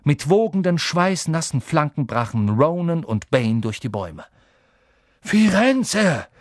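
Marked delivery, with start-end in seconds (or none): 5.2-6.3 high, loud thundering shout of the name; thundering call. A narrator's reading. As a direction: bellow the name across the clearing. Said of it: "donnerte Bane".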